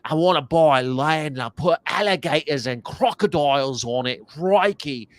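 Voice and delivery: playful Aussie bloke bravado; Steve Irwin impression. Mocking Steve Irwin's money quote, lightly Australian. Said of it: "And he".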